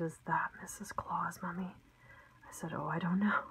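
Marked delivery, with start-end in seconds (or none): 0.0-1.7 a hushed little girl's question, barely voiced; child's whisper. Child whispering about Missus Claus. 2.7-3.4 hushed reply. Retold or imitated. Mom whispers reply to child.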